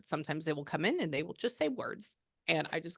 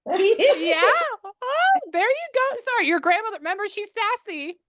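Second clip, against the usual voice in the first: high, loud delighted outburst; amused excitement; 9 dB louder than usual. Real, not acted. Medium to client about a sassy grandmother, loudly amused. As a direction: exclaim it, pitched way up.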